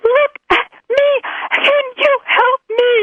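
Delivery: high, wailing plea through tears; crying plea. Retold or imitated. Retelling crying to the spa woman for help, high tearful plea. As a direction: beg for help, half sobbing. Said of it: "of course I'm crying".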